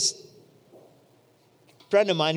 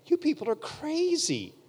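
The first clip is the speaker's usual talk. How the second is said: scoffing; 8 semitones higher, 3 dB quieter, slower than usual. Retold or imitated. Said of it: "I'm thinking".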